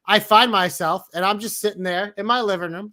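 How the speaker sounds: normal talking voice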